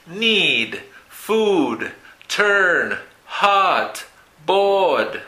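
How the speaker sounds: "Need, food, turn, hot, board" each stretched and falling; long vowel demo. Shown as a demonstration. Teacher repeats Need, food, turn, hot, board for learners, long vowel demo. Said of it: "Son sonidos largos".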